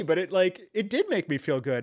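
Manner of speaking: ordinary talk, nothing marked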